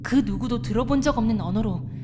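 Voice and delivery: measured, hushed storyteller voice for a creepy tale; ghost story telling. Acted. Telling a companion a village ghost story, measured storyteller voice. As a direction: draw the listener into the grim legend.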